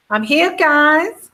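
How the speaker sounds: slow, high mock American accent. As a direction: put on a hammed-up American drawl, half laughing. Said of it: "American accent".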